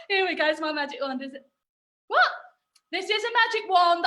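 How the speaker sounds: usual speaking voice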